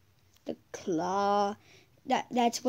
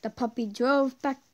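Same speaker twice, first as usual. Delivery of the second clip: once-upon-a-time reading voice; storybook narration; 3 semitones higher, 3 dB louder than usual. Acted. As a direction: lightly read fairy-tale narration. Reads game story aloud.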